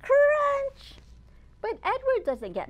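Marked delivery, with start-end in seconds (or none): 0.0-1.0 loud, high crunch crunch; crunchy sound effect. A narrator's reading. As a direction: make the crunch pop out. Sound effect words in story.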